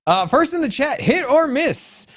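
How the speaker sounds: high, upbeat call-out; excited roll call. Real, not acted. Host calling out roll call.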